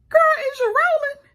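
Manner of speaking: high, loud disbelief; incredulous. Acted. Scolding the character on screen, high loud disbelief. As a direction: shriek it in disbelief.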